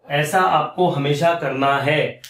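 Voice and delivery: ऐसा आपको हमेशा करना है drawn out slowly, word by word; slow emphatic repeat. Shown as a demonstration. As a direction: stretch हमेशा and करना to drive the rule home. Teacher repeats the rule for emphasis. (धीरे-धीरे, ज़ोर देकर समझाते हुए)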